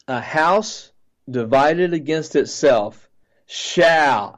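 slow, measured declaration landing hard on "shall"; stress on SHALL. Retold or imitated. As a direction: imitate a proclamation, "shall" drawn out and loud. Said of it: "he emphasized the word shall loudly".

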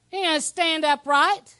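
ordinary delivery

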